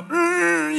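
high strained groan, winded. Retold or imitated. Boy knocked flat on his back groans for breath, high and strained. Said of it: "making these noises".

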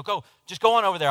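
quick, loud, high dismissal; acted frustrated order; re-enacted irritation. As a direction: shoo the kid away impatiently. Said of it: "And so I just yell".

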